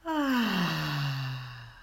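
long exhale sighed out aloud; loud audible sigh. Shown as a demonstration. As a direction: breathe out with a big audible sigh. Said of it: "release with a loud, audible sigh".

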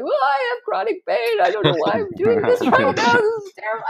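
high, laughing through the pain; laughing despair. Retold or imitated. Runner telling strangers about her pain, high and laughing. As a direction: laugh at your own misery.

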